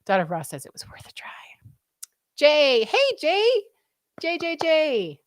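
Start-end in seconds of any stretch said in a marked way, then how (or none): 0.7-1.3 voiceless whisper of the quote. Real, not acted. Reads chat in whisper. 2.4-5.2 high, louder call to Jay, slowing on the repeats. Real, not acted. Calling out to a viewer.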